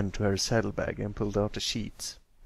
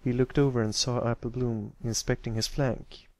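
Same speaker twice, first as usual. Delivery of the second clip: ordinary delivery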